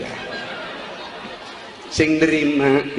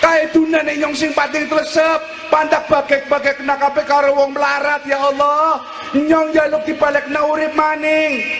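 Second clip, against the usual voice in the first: acted pleading of the damned; crying plea. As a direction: bargain with God in a high wail. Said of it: "Kowe wong pada nangis".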